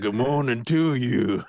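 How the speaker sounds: high, loud and slow mock seduction; sultry greeting. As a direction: purr a playful good morning. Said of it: "was like".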